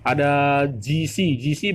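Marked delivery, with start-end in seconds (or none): 1.3-1.7 G C with clear i vowels, ji-si; voiced j and bright ee in G C; wrong reading ji xi. Shown as a demonstration. Teacher showing the misreading, i vowels. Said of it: "bukan dibaca G C".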